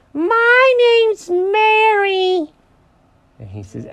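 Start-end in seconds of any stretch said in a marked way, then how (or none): 0.1-2.5 very high, slow, loud rabbit; over-the-top high cartoon introduction; absurd bunny voice. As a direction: stretch it out in a hammed-up squeal. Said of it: "is that how you talk?".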